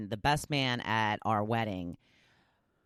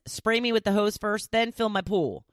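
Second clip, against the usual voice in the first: higher demanding pet; bossy pet voice; 9 semitones higher, 4 dB louder than usual. Pretend. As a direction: order it like a spoiled dog. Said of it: "Abby says".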